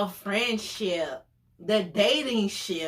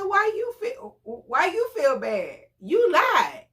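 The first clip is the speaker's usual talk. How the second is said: high, louder sassy comeback; incredulous scold; 10 semitones higher, 3 dB louder than usual. Retold or imitated. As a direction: call him out with attitude. Scolding the lying date.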